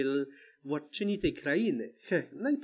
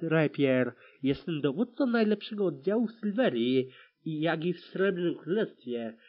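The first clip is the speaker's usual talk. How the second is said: ordinary delivery, nothing marked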